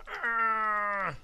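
loud high growl of effort; frustrated roar. Real, not acted. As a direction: let out an angry yell. Frustrated in-game cry.

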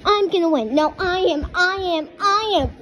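loud, boastful toy voice shouting its case; a toy loudly insisting it will beat the others; bragging toy. Pretend. Toys quarrel over who wins.

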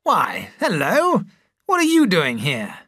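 slow surprised greeting. A narrator's reading. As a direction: caught off guard by an old friend. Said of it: "looked at him in surprise".

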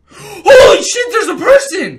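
high, loud shriek of fright, drawn out; terrified scream. Real, not acted. Reacting to a horror video, a terrified scream. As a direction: scream as the figure appears.

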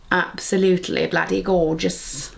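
ordinary talk, nothing marked

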